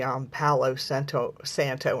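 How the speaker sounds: ordinary talk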